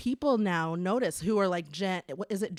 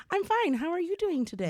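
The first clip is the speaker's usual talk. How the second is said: put-on chirpy polite kid; 9 semitones higher than usual. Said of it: "I'd be like".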